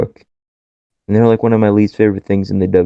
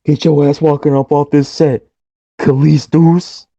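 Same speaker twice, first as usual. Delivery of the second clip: mock promo yelling at Kalisto; hammed-up wrestling taunt; Miz impression; 6 semitones higher than usual. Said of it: "the closest thing to The Miz".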